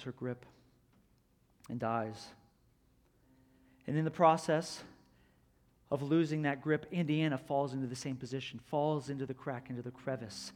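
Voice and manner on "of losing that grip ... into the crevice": ordinary delivery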